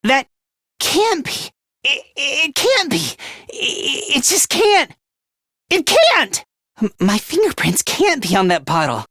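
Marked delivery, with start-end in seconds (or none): 0.0-6.5 cornered and refusing to believe it; panicked denial. Acted. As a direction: stumble over the words as panic climbs. Acted culprit cornered by evidence.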